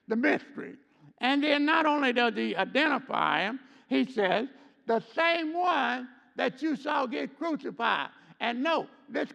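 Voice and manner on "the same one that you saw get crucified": higher, ringing preacher's call; preaching emphasis. Real, not acted. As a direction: press the point to the congregation.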